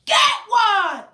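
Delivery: loud, high shout. Shown as a demonstration. Teacher yelling along with the children, loud shout. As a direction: yell it with the kids. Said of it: "we're gonna yell out".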